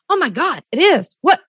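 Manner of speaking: high, startled yelp of discovery; surprised. Retold or imitated. Answering the chiropractor's question, high startled surprise. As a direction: act shocked that the spot is sore. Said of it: "And I was like, gasps".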